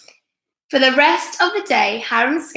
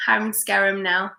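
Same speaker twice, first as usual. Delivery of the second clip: ordinary delivery, nothing marked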